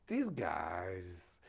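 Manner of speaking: low, slow, ominous understatement; mock-grave hushed caution; grave retold warning. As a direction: drop low and draw out each word.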